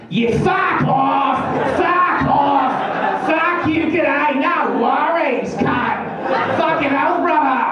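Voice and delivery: high, slow squawking Aussie shouts; Australian bird squawk. Pretend. Australian birds swearing at people in high, slow Aussie squawks. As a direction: screech it like a rude Australian bird. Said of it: "Australian birds sound like this".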